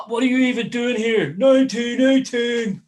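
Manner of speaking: high, jeering crowd shout; heckling Yankees fans. Retold or imitated. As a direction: taunt loudly at a pitch well above your own, dragging out the chant. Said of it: "all you hear are them".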